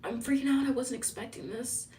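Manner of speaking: breathy high mock freak-out; flustered gushing. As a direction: imitate a woman thrown off by flowers. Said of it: "Are you like".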